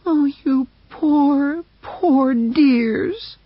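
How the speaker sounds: high, slow cooing voice; tender pity. A narrator's reading. Mercedes hugging Buck, tender cooing. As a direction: coo over the dogs. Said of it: "she said".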